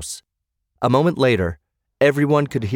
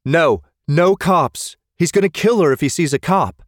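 urgent, higher-pitched plea; alarmed refusal; 7 semitones higher than usual. A narrator's reading. Friend begs others not to call the cops, alarmed. Frantic plea against calling police.

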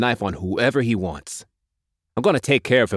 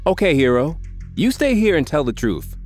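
higher, mocking, dry tone; sarcastic Mick; 4 semitones higher than usual. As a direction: needle the do-gooder.

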